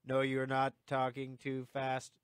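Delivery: slow, evenly spaced words; drawn-out, deliberate mock reply; mock-slow reading. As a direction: read it out slowly, word by word. Teasing reply read out slowly.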